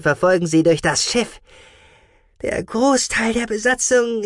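nervous little creature squeaking; squeaky mouse-beaver. Acted. Gucki's voice in the story.

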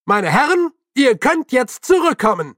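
high, loud call through cupped hands. A narrator's reading. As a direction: call people back from far away. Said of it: "wölbte die Hände trichterförmig vor dem Mund und rief".

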